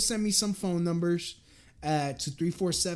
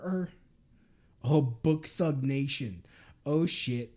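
ordinary talk, nothing marked

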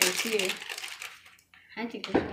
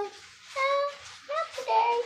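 toy character voice; 13 semitones higher, slower than usual. Acted. Voices funnel toy again.

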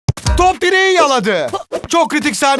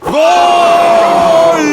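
shouted goal call; screaming football commentator; 5 dB louder than usual. Acted. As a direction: yell the goal out loud.

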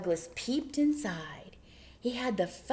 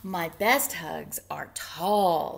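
slow thoughtful; 5 semitones lower, 3 dB louder, slower than usual. Acted. Bear voiced, slow thought.

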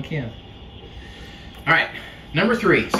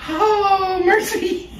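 high, slow laughing voice; 13 semitones higher, much slower than usual. Real, not acted. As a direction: laugh while you speak. Both laughing over mouthwash.

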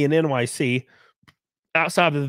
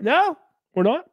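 yelped "No", much higher; sharp refusal; 10 semitones higher than usual. Acted. Man flatly refusing the maid's celebration, yelped. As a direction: cut her off in high alarm.

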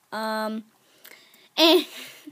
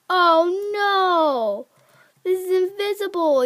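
raised, louder cry of alarm; dismayed outcry; 5 semitones higher, 6 dB louder, slower than usual. Real, not acted. Gamer spots an invisible obstacle, dismayed outcry. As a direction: exclaim in dismay at a nasty surprise.

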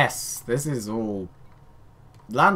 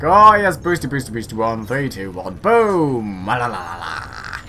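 gleeful ship countdown; 2 semitones lower, 6 dB louder than usual. Pretend. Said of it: "and it's like".

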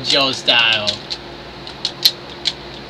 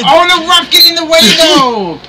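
high, fast yelp of complaint; frustrated outburst; 11 semitones higher, 4 dB louder than usual. Real, not acted. Player blaming the ref for blocking him, frustrated high outburst. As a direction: rush the protest out in a high voice.

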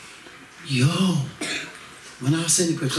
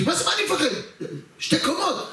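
high, panicky disbelief with a laugh; high nervous protest against the threat; scared laughing protest; 10 semitones higher than usual. Retold or imitated. Retorting scared to the owner.

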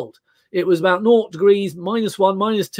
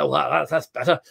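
chaps' contented sigh; 3 semitones lower, 4 dB quieter than usual. Retold or imitated. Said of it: "we all say".

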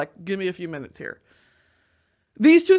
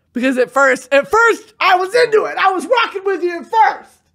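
high, raised, exasperated complaint; mock protest; 8 semitones higher, 6 dB louder than usual. Viewer telling off video maker, high mock protest. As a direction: climb higher as you protest.

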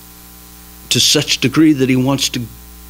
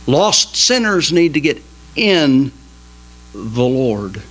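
ordinary delivery

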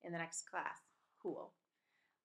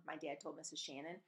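plain reading voice

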